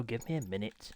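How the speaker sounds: a muttered aside after snapping. Real, not acted. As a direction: drop it right down, muttered to self. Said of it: "Sorry for my temper and attitude there".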